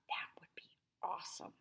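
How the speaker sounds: whispered private hope; a soft unvoiced whisper of hope. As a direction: breathe it like a secret wish. Julian's hopeful private thought.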